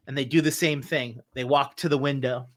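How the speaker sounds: ordinary talk, nothing marked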